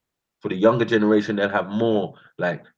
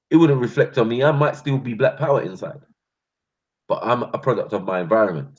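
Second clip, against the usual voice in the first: ordinary delivery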